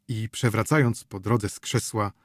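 plain reading voice

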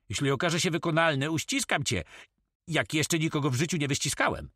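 quick, high-pitched promise; fast, animated character voice; eager pirate comrade. Acted.

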